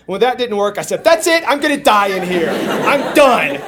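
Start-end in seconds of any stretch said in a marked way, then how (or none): 1.0-3.6 high, loud cry of defeat; melodramatic despair. Retold or imitated. Trapped man gives up after pulling the door, melodramatic despair. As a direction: give up in hammed-up panic. Acted giving up in panic.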